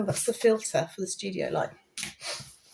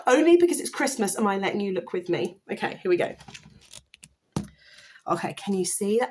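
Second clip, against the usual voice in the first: normal talking voice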